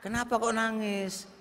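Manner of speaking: bertanya lembut dan pelan, agak tinggi; suami bertanya pelan. Retold or imitated. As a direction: tirukan suami yang heran, suara ditahan. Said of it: "wae ditanya". (suami bertanya lirih pada istri yang menangis)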